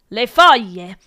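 short loud outburst; shouting. A narrator's reading. Professor answering the pupil about the leaves, shouting. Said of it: "urlò il professor Prurity".

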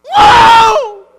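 huge high shouted whoa; stunned shout of sight regained; amazed shout. Acted. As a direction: cry out in amazement at seeing. Mimics healed blind man's amazement.

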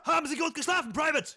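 very high, barked drill-sergeant orders; stiff barking toy soldier. Acted. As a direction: bark it like a tiny wind-up sergeant. Comic drill skit, sergeant. (hoher, zackiger Spielzeugsoldaten-Befehlston)

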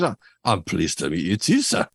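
put-on reply in a casual, understated Scottish accent; a hint of David Tennant's Scottish accent. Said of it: "In a, in a Scottish accent".

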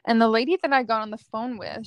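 ordinary talk

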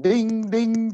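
high, sustained sung-out dings; imitated door chime. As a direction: mimic a car door chime. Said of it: "the chimer is going".

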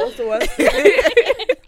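slow, loud laughing groan. Real, not acted. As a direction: drag it out through laughter. Laughing exclamation.

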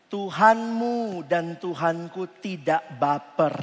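ordinary delivery

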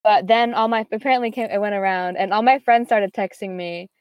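ordinary delivery

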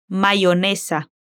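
Mayonesa with the Y said like an i, "maionesa". Shown as a demonstration. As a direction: glide through the Y in Mayonesa as an i. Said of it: "no pronuncia la Y con sonido de sh, sino como si fuera una I".

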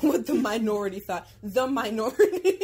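loud repeat collapsing into laughter; mocking laughing echo. Host teasing co-host over an odd phrase, laughing mockery. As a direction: throw the words back, cracking up.

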